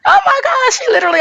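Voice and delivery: normal talking voice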